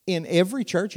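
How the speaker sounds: mock surprise. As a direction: ask it in disbelief, pitch up. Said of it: "And I'd go".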